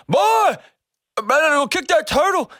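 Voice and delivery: put-on high-pitched French-accented shout; loud imitation of a French-accented old-timer. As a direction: holler it as an old French-speaking bayou man. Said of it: "in that French accent".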